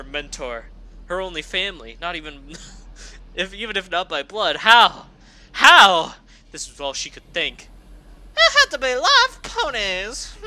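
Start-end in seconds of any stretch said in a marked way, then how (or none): 4.6-6.1 loud anguished How; desperate cry. Acted. Reader voicing a shocked pony, crying out How. Narrator voices desperate question. 8.4-10.4 villain gloat. Acted. Villain reveals evil plan.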